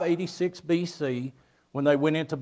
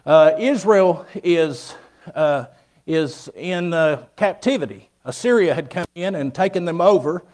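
preaching; 6 dB louder than usual. Acted. Preaching to the congregation.

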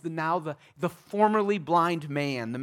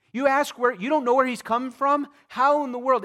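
much higher, louder incredulous marvel; amazed; 8 semitones higher, 6 dB louder than usual. Real, not acted. Preacher marveling to the congregation, high and amazed. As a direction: marvel out loud at it.

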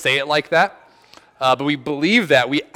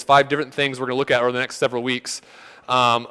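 ordinary talk

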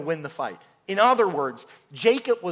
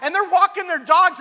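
usual speaking voice

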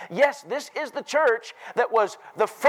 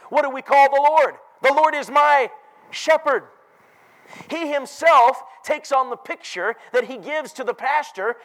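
higher and louder, rhythmic preacher build; preaching at full force; 4 semitones higher, 3 dB louder than usual. Acted. Preacher to congregation on shepherds, full-force preaching. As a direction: drive each shepherd line upward to the peak.

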